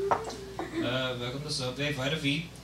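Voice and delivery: put-on low, soft accented worker; quiet server with V for W; Subway sandwich worker. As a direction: mumble the greeting low and quiet. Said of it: "now works for Subway sandwiches".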